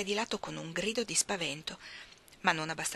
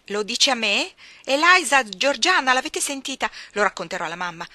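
loud, higher calling to his sisters; outraged boy; 4 semitones higher, 10 dB louder than usual. Acted. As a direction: tattle in furious indignation. John calls his sisters outraged. (ragazzo indignato che chiama forte)

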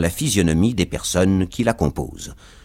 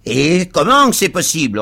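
loud, high retort; indignant cab driver; 8 semitones higher, 6 dB louder than usual. A narrator's reading. Cab driver answers a fellow cabman, indignant retort. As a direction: throw it back, pitched up and indignant. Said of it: "répondit le premier".

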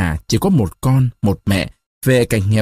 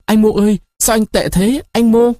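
tearful call; 9 semitones higher than usual. A narrator's reading. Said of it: "Người nhân tình phát khóc".

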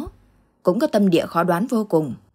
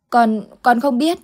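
put-on frightened child; 5 semitones higher, 4 dB louder than usual. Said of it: "thái độ hết sức hoảng loạn".

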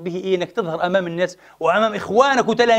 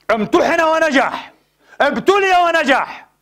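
slow, much higher, each word lifted in praise; preacher exalting the tested believer, high and slow; rising preacher's emphasis; 8 semitones higher, slower than usual. Acted. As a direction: proclaim it slowly from the pulpit. Preacher exalting the one who passed.

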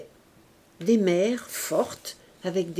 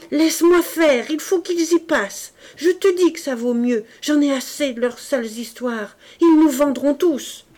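loud, higher, frenzied pleading; crazed insistence; 7 semitones higher, 8 dB louder than usual. A narrator's reading. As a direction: push past her in a fury. Madman insists, knife in hand.

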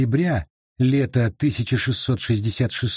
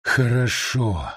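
slow creature voice; slower than usual. Acted. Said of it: "согласился призрак".

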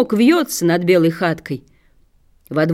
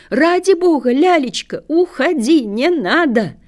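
high, urgent appeal; raised, pleading old-lady voice; old lady imploring; 7 semitones higher than usual. A narrator's reading. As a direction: beg the child to come away. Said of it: "взывает старая барыня".

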